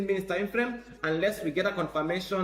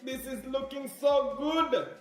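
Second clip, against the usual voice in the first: shrieking jackpot winner; 5 semitones higher than usual. Real, not acted. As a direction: squeal it like you just won. Trader thrilled by chart.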